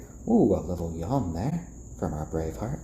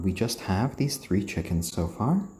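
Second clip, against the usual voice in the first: normal talking voice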